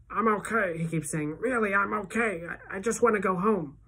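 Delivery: weak, croaky voice. Acted. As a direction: croak out the words. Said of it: "in this croaky voice".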